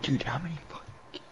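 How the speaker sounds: hushed, breathy whisper. Real, not acted. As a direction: whisper it under your breath. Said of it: "Why are you whispering?".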